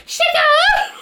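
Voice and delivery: very high shouted squeal, laughing; squealed imitation. Cohost retelling the clip, squealing it high. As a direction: shriek shut up like the clip. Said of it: "he was like".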